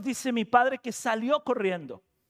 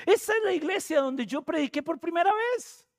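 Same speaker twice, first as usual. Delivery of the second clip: high-pitched amazed exclamation; retold astonishment, voice raised high; astonished realization; 9 semitones higher than usual. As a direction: relive the discovery, pitch leaping up. Retells his astonished discovery to his father.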